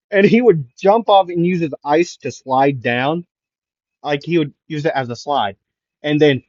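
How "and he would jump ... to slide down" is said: ordinary delivery, nothing marked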